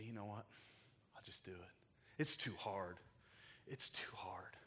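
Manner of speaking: hushed, unvoiced giving in; defeated whisper. A father whispering that it's too hard and giving up. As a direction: whisper it like you're quitting.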